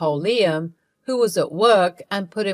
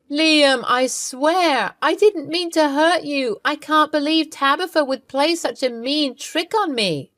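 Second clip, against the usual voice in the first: higher, pleading cry to her husband; desperate wife pleading on the phone; 6 semitones higher than usual. Acted. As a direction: beg him not to go, voice pitched up. Wife begs husband not to leave.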